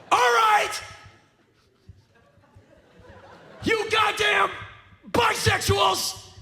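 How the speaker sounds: mock outraged rant. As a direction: roar the punchline like a crazed old man. Staged mock rant punchline.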